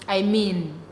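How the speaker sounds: ordinary talk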